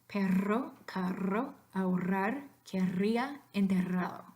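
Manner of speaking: perro, carro, ahorrar, querría, enterrado with a long strong trill; exaggerated rolled R. Shown as a demonstration. Teacher exaggerates the trill for students, perro with a long rolled R. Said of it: "I will exaggerate a little bit".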